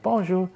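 妻子的法语问候; wife's French greeting. Retold or imitated. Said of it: "she would be like".

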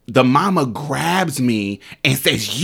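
usual speaking voice

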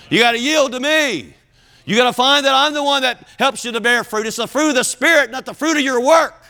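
high, driving pulpit delivery; impassioned preaching. Acted. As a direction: press the lesson home to the congregation. Preaches at full force.

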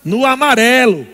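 ordinary talk, nothing marked

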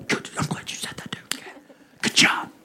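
hushed whisper of a passing student. Retold or imitated. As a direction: whisper the praise on the way out. Students whispering as they pass.

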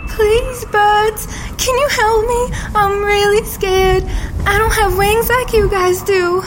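usual speaking voice

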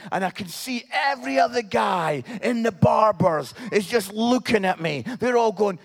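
usual speaking voice

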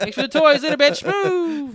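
high, loud, slow mocking voice with a laugh; mocking brush-off. Slamming the door on the neighbor, hammed-up high sneer. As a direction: drag out move like a snotty brat. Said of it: "I was like".

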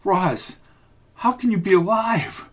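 high, tearful disbelief; through tears. A narrator's reading. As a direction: ask it close to crying. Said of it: "through her tears".

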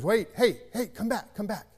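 high, urgent storekeeper call; calling him back. Acted. Storekeeper calls the disappointed boy back, high urgent call. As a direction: call out quickly after someone walking away.

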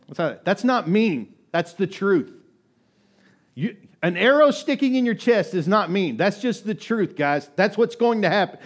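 loud, rising preacher's insistence; preaching at full force. Acted. Pastor preaches loudly that truth is not mean. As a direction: drive the hard truth home to the congregation.

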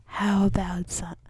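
breathy unvoiced ASMR whisper. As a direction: hush it like an ASMR video. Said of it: "is this how you do ASMR".

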